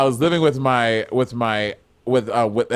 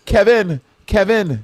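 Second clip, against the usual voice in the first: Saint Lucian accent; 7 semitones higher, slower than usual. Shown as a demonstration. Showing the host what a real Saint Lucian accent sounds like. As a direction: call Kevin the Saint Lucian way. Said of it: "Saint Lucian is like this".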